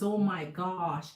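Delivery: normal talking voice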